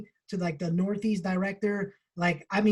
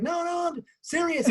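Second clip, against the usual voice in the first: high Texas twang insisting; a Texan assuring him he means it; mimicked Texan insisting; Texas accent; 9 semitones higher than usual. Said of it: "with a strong accent draw".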